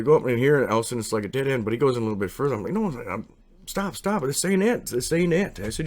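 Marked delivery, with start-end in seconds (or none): 2.7-5.6 high, urgent cry to stop. Retold or imitated. Narrator halting his friend's boat at a dead end, high and urgent. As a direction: yank him to a halt with a high urgent call.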